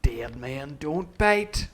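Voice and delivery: very slow, loud menacing line; grim pirate. Retold or imitated. Young Long John deciding the captives' fate, very slow. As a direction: drawl it out like a cold killer. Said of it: "All the men are like that".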